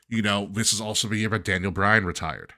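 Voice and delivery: usual speaking voice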